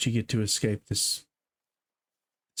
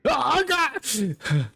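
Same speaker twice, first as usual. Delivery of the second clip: startled cry. Real, not acted. Startled by monster in game.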